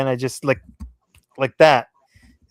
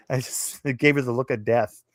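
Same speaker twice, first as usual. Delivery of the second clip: normal talking voice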